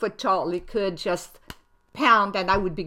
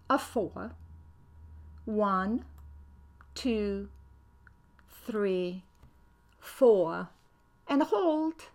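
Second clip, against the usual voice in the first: guided meditation. Acted. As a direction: breathe the count out calmly.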